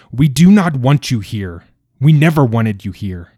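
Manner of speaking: loud and high, furious at the king; angry shout. A narrator's reading. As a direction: hurl it at him, grief turned to rage. Grieving father rages at king.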